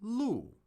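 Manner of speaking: 路 said with a sharp falling fourth tone. Shown as a demonstration. Teacher quizzing the chat, sharp fall on 路. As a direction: drop 路 hard from high. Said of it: "which one is 路?".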